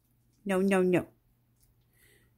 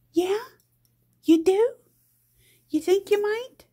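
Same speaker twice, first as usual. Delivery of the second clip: much higher, sing-song coo; 12 semitones higher, 6 dB louder than usual. Real, not acted. Mom cooing to her pet in a much higher voice.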